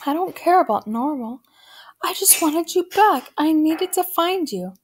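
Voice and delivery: high, pleading mother on the edge of tears; tearful. A narrator's reading. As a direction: plead through acted tears, pitch climbing. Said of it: "She started to cry again".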